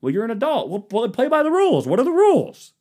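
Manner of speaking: high, indignant whine; mock petulant grown-up; high whiny complaint. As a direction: sulk like a sore loser. Mock argument with adults.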